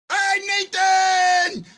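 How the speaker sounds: high, drawn-out holler; excited high call. Real, not acted. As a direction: holler the name to a buddy, stretched long. Calling out to a buddy.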